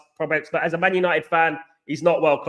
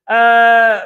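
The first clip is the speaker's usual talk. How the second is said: loud drawn-out uh; 4 semitones higher, 10 dB louder than usual. Real, not acted. Loud noise between comments.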